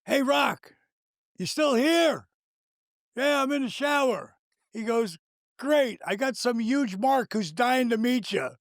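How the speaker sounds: high, far-off giant's voice; Big Show impression. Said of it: "Big Show go".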